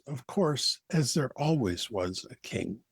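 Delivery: ordinary delivery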